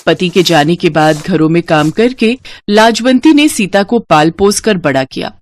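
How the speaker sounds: usual speaking voice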